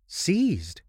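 high, sudden cry of hope; eager exclamation. A narrator's reading. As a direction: leap on the word in sudden hope. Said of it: "I exclaimed, starting eagerly".

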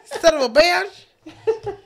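high, drawn-out Jamaican wail breaking into laughter; Jamaican accent attempt. Recruiter promises fame, high laughing Jamaican accent. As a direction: plead in a Jamaican accent, cracking up. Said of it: "Don't judge me on my accent".

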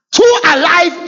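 high, loud warning cry; preaching cry. Acted. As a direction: call it out as a warning.

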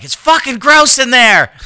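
high-pitched, loud mock protest; disgusted yell. Wife refusing the bedroom bathroom, disgusted yell. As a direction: imitate a wife yelling in disgust.

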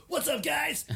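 hammed-up, high-pitched celebrity impression; Guy Fieri. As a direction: greet like an over-the-top TV host. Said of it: "Well, you always talk like that".

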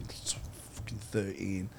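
usual speaking voice

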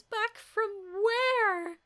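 high, loud, drawn-out disbelief; incredulous. Retold or imitated. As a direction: stretch the question in shock. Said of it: "And she goes".

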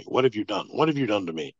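half-acted yell of someone frozen in place; retold panicked scream. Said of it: "he's, like, screaming at me".

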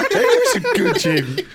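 put-on high, loud baby talk to a pet; talking to a dog. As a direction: coo like praising a good boy. Praising a friend like a dog.

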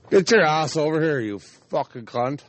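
high, loud scolding call, easing at the end; yelling at the dog. Real, not acted. Owner ordering the runaway dog back over, cross yell. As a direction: holler crossly at a runaway pet.

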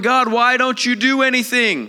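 high, loud frantic cry; desperate shouted complaint; freaking out. Acted. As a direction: wail at God in acted panic. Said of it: "They are freaking out, going".

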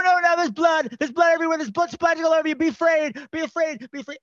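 high-pitched hammed-up hype yell at the crowd; director yelling at extras. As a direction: whip the extras into a frenzy, mock-dramatic and high. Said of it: "He's yelling at them".

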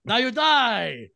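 high, loud mob jeer; mock the screaming crowd with drawn-out yells; hammed-up crowd scream. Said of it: "and just screaming".

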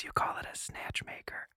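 whispered joke; a cheeky whispered quip at the table. Real, not acted. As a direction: slip the punchline in under your breath. Joking aside.

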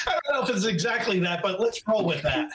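laughing as you humour an odd comparison; amused, laughing. Real, not acted. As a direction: chuckle while half-disagreeing, quick at first. Amused reply to odd dog analogy.

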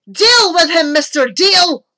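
high, loud villain's order; cry. A narrator's reading. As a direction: cry out a command to a henchman. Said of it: "cried Barker".

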